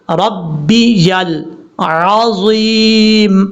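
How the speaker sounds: ربی یل عظیم very slow, each letter separate and clear; spelled-out clear letters. Shown as a demonstration. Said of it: "اس کو clear کرنا ہے".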